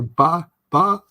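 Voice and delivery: loud teasing Norfolk chant of butter; mocking Norfolk accent. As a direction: tease like a friend in a Norfolk accent. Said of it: "she'd go".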